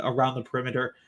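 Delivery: usual speaking voice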